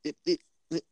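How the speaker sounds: stuttering robotic connection glitch; glitchy pitch jumps. Retold or imitated. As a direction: repeat it like breaking-up audio. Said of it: "You sound like a robot. You're like".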